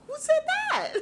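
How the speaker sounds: high, loud, laughing mock bewilderment; baffled disbelief. Everybody looking around for the accuser, baffled. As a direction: squeal the question in disbelief.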